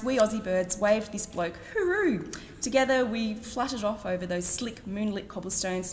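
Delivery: ordinary talk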